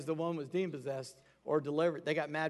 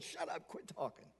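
sharp whispered command; a whispered shut up; hushed rebuke; 13 dB quieter than usual. Retold or imitated. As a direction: hiss it at them to be silent. Jesus rebuking the demons.

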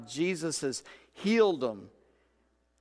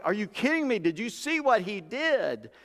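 amazed disciples; 7 semitones higher than usual. Retold or imitated. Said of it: "They're like".

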